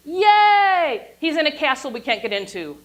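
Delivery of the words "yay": high, loud mock cheer. Lecturer cheering for the hero, high and loud. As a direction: cheer like a fan at the movies.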